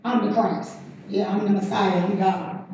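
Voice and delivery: calm low reassurance as Jesus; Jesus telling his cousin John he is the Messiah, low and soft; Jesus answering. Retold or imitated. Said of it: "send the message to him and say".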